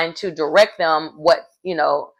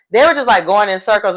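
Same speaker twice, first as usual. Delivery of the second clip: usual speaking voice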